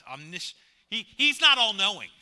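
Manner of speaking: ordinary delivery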